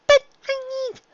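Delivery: put-on very high, loud child plea; shrill little-girl protest; filly voice. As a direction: plead like a small filly.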